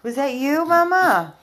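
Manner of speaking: loud scolding call. Real, not acted. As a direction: catch the dog in the act. Scolding dog on camera.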